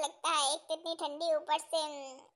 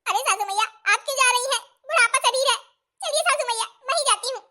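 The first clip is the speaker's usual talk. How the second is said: put-on higher, louder fretting young bride; 7 semitones higher, 6 dB louder, faster than usual. New bride alone in kitchen, fretting in high voice. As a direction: fret like a clumsy new bride.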